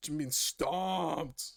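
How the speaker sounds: very slow, stretched complaint; drawn-out whine. Real, not acted. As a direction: drag the words out in defeat. Dragged-out complaint.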